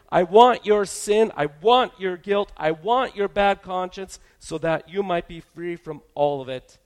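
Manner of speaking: loud, rising sermon voice; preaching at full force. Acted. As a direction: proclaim it to the whole congregation.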